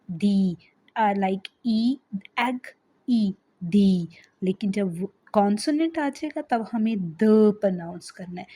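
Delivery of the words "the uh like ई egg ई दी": "दी" said with a long ee, separated out; "the" as dee. Shown as a demonstration. Teacher models the vowel rule for students, said clearly. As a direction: draw out "ई, दी" slowly like a model sound. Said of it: "we should have to pronounce it as".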